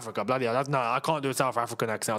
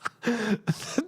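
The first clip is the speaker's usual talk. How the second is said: helpless burst of laughter; laughing; 6 semitones higher than usual. Real, not acted. Streamer amused by a request for Trump, laughing. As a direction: crack up.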